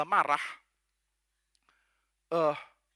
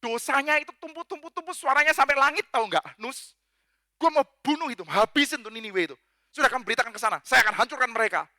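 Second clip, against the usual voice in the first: lightly acted, higher-pitched wrathful God; animated divine complaint, pitched up; God addressing Jonah; 5 semitones higher, 4 dB louder than usual. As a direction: rant about Nineveh in colloquial outrage. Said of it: "Dia bilang".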